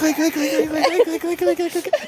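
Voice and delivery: rapid high clanging noise; relentless high-pitched clangs; imitated pot clanging. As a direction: keep banging the imaginary pot. Imitates banging on pot lid.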